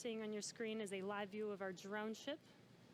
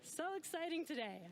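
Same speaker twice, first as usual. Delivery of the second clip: excited; 7 semitones higher than usual. Real, not acted. Still excited after landing.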